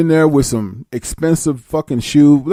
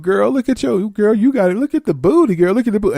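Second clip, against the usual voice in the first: put-on high, teasing girl talk over the booty; 6 semitones higher, faster than usual. Friend teasing the new girl about her body, high and playful. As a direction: hype her up like a catty friend. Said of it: "They be sitting there going".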